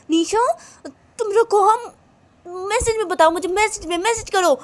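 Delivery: high, agitated telling-off; annoyed friend. Acted. Friend scolding another for calling instead of messaging, high and annoyed.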